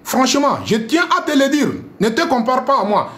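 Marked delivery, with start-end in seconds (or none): none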